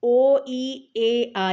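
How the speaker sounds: او، ای، اے، آ sounded loud and long; vowel row demo. Shown as a demonstration. Teacher sounding the vowels for pupils. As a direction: call out each vowel clearly. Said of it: "یہ ان حروف کی آواز کو".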